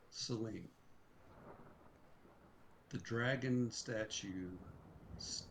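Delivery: slow, low, suspenseful narration; quiet, ominous reveal; game master scene-setting. A narrator's reading. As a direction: let it unfold softly with long pauses.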